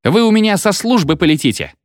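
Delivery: high-pitched angry threat; shrieky outrage, kept in check; indignant squeal. A narrator's reading. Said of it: "взвизгнула фигура в окне".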